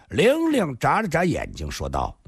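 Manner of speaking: plain reading voice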